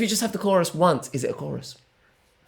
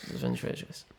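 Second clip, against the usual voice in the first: whispered, voiceless and hushed; 14 dB quieter, faster than usual. Real, not acted. Restated, then whispered.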